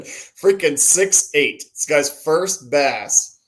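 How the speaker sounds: ordinary talk, nothing marked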